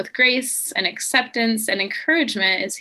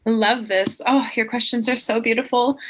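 ordinary talk

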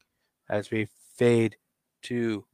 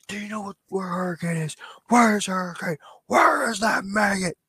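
high, loud screaming demand for Hurricane; mock sergeant screaming, pitch way up; 9 semitones higher, 3 dB louder, faster than usual. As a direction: yell it at everyone in the hallway. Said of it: "Just screaming at everybody".

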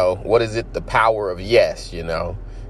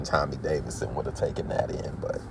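unvoiced whispered aside; whispering; 6 semitones lower, 9 dB quieter than usual. Real, not acted. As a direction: whisper it like a secret.

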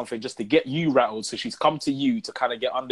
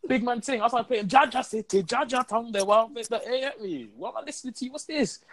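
much higher, hyped party voice; 9 semitones higher than usual. Pretend. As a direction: act hyped, blasting music. Mock playing loud music.

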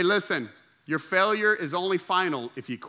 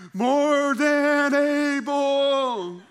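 drawn-out, high, triumphant "More than able"; confident boast; 8 semitones higher, 5 dB louder, much slower than usual. Retold or imitated. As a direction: sing it out like a proud believer. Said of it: "you're like".

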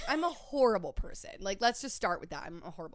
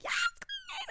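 very high squeal; squealed yes queen; 4 dB quieter than usual. Retold or imitated. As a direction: shriek it like a fan. Agreeing to a coworker.

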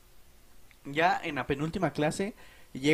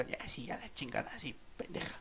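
under-breath mutter; 15 dB quieter than usual. Retold or imitated. Mutters insult at apologetic woman.

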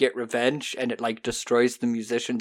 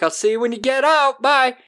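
much higher, louder, chirpy send-off; breezy goodbye; 11 semitones higher, 5 dB louder than usual. Retold or imitated. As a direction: wave her off cheerfully, unbothered. Husband breezily dumping wife.

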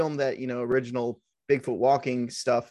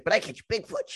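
higher-pitched nerd impression; nerdy guy; 5 semitones higher than usual. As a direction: imitate an earnest nerdy hobbyist. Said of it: "one nerdy guy".

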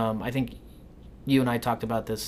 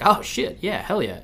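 mock excited cheer; 6 semitones higher than usual. Retold own excited reaction.

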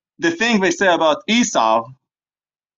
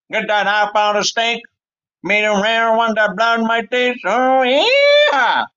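put-on high-pitched, hollering Texan cowboy accent; mock American Texan; 5 semitones higher than usual. Hungry Texan demanding a rare steak, in a mock American cowboy accent. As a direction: whoop and jabber like a caricature Texan.

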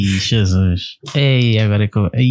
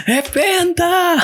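excited; much slower than usual. Pretend. Teasing the unbeaten champion.

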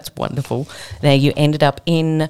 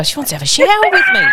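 high, fast excited bloke voice; a hammed-up bloke blurting it out; mock bloke voice; much faster than usual. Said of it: "that bloke would go".

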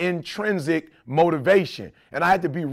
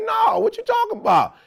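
much higher, shouted disbelief; high shouted mock disbelief; baffled retold reply. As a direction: sell your confusion, way up high. Retells own baffled reply.

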